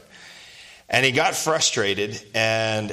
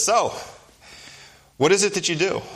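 a doctor's raised questioning voice, higher; doctor asking; 9 semitones higher than usual. Retold or imitated. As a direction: play the curious doctor, pitched up.

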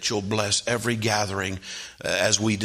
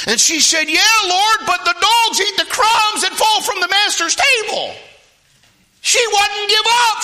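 very high, loud preacher's shout; shouted pulpit storytelling; full-force preaching; 8 dB louder than usual. Acted. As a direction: preach it at full cry, impassioned.

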